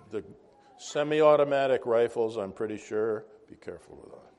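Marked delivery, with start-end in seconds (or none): 3.5-4.3 low unvoiced aside, much softer; hushed mock warning; whispered warning. Real, not acted. Warning aside after joke.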